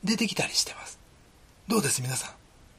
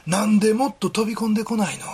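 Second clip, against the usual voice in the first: pleading father; 5 semitones higher, 5 dB louder than usual. Retold or imitated. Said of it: "って".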